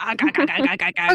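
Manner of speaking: hammy pirate arrgh; pirate arg. Acted. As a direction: growl pirate noises. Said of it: "do a pirate voice".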